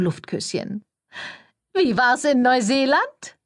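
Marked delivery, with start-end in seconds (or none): 1.7-3.4 high, loud, slowing gush; sugary high-voiced schmoozer; gushing publicist. A narrator's reading. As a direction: coo it like an overeager press lady. Cordelia making small talk.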